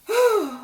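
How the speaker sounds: a high, exasperated sigh; long exasperated sigh. Real, not acted. Host watching Aaron force a tear, exasperated sigh.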